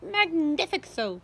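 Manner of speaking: loud, slow gloating exclaims; greedy delight. A narrator's reading. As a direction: relish each word greedily. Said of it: "Aunt Sponge cried out".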